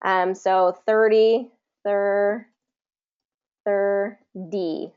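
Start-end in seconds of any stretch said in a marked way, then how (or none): 1.8-4.9 thir- broken off, then a lone D; syllable by syllable. Shown as a demonstration. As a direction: split thir- to show the D. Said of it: "get that D sound".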